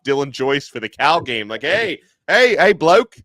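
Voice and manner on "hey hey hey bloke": loud, high mock Australian greeting; hammed-up Australian accent, higher and louder. Podcaster mimicking an Aussie punter, hammed-up Australian accent. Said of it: "I'm trying to do the Australian accent".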